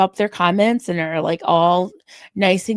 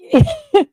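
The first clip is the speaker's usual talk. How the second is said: high laugh; 5 dB louder than usual. Real, not acted. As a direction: burst of high giggling. Laughing at chat comment.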